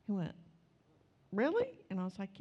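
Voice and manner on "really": surprised. Retold or imitated. Man surprised it's a church.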